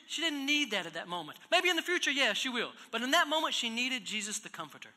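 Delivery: a preacher pressing the congregation, loud and high; full-force preaching. Acted. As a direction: drive the point home from the pulpit.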